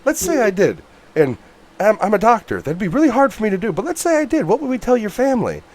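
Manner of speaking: a lightly voiced doctor talking someone down; high-pitched impression of the calm doctor; imitating Oldman. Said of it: "Oldman has that line where he's like".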